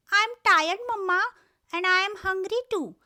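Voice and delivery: put-on a small boy tugging at his mama; tired hungry little rabbit. Little rabbit complaining to his mother, high and whiny.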